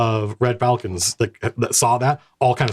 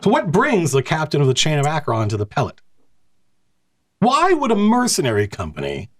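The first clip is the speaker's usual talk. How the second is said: a lightly voiced scornful officer, pitched up; haughty commander; 6 semitones higher than usual. Commander interrogating the mercenary captain, scornful.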